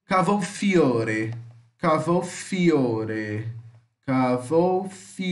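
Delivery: cavolfiore drawn out slowly, every syllable clear; slow Italian model word. Shown as a demonstration. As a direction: spell out cavolfiore slowly for students. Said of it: "teacher models Italian word slowly".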